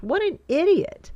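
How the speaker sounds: high, loud, exasperated jab at the salesman; exasperated outburst. Real, not acted. Fed-up homeowner venting about a pushy salesman, exasperated outburst. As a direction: spit it out in disbelief.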